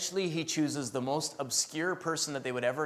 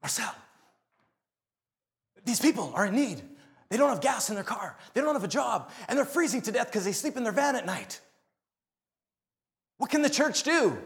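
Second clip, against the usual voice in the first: high, loud, urgent pleading; mock anxious church lady, rising to a cry; urgent plea; 8 semitones higher, 4 dB louder than usual. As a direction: rush up begging for help for the needy. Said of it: "She runs up to me".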